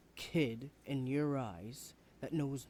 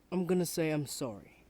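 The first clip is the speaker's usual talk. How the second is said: forceful apology; 3 semitones higher, 7 dB louder than usual. Acted. Student apologizing with raised voice.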